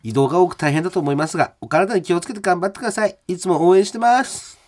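high, quick, upbeat radio letter reading; listener mail read. Acted. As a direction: rattle off the fan's good wishes in a high voice. メールの続きを読む. (高めの声で早口にメール朗読)

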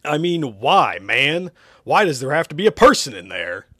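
loud, irritated arguing; exasperated. Acted. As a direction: press the question in disbelief. Irritated argument with Hugh.

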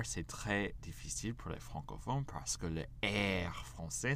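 ordinary talk, nothing marked

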